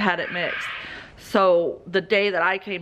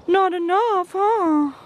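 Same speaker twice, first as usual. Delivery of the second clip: much higher, sing-song; pet talk; 9 semitones higher, 4 dB louder, slower than usual. Real, not acted. Owner telling the dog about the tarp, high pet talk. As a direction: tease the dog sweetly.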